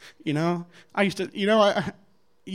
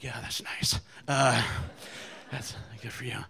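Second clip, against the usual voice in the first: very quiet and low, almost under the breath; small, timid voice, imitating nervous politeness; meek, intimidated reply; 5 semitones lower, 7 dB quieter than usual. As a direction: barely dare to say it. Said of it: "just scared of this guy".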